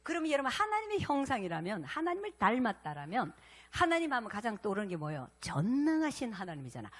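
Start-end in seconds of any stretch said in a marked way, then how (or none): none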